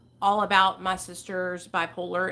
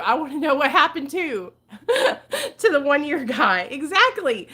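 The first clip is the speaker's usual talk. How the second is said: louder, higher, laughing mid-sentence; amused laughing; 5 semitones higher, 5 dB louder than usual. Real, not acted. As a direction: break into a real laugh as you speak. Laughs replying to a viewer.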